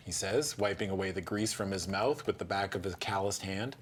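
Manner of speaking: ordinary talk, nothing marked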